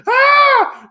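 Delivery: imitating a burnt girl's shriek; mock yelp of pain. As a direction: yelp as if the glass just burnt you. Girl's hand burnt by flame.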